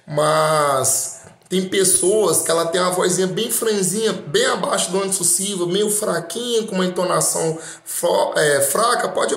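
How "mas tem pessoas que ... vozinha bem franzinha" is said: ordinary talk, nothing marked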